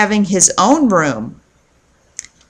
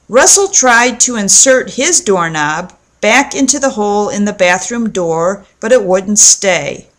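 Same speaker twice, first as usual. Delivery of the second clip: ordinary delivery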